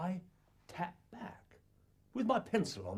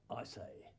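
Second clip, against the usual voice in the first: ordinary talk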